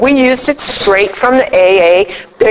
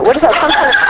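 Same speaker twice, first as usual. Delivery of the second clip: mock disbelief; 4 semitones higher, much faster than usual. Imagined mocking reply to sponsor.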